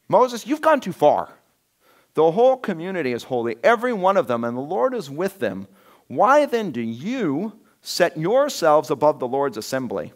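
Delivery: accusing. Retold or imitated. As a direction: throw the charge in his face. Rebels confronting Moses.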